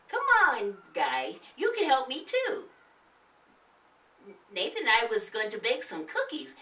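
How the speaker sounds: high, chirpy granny voice; a friendly old lady greeting guests; old woman voice. Acted. The old woman greeting the kids, high and chirpy.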